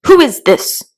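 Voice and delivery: sharp, loud demand from the queen; a stern royal challenge; severe. A narrator's reading. Queen demanding to know the stranger, severely. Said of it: "the queen said severely".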